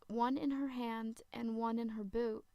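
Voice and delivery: ordinary delivery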